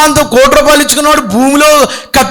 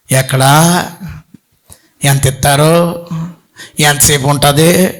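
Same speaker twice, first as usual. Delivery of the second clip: lazy man; 8 semitones lower, 4 dB quieter than usual. Retold or imitated. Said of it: "పనికి రమ్మన్నారు అనుకోండి సోమరోడిని".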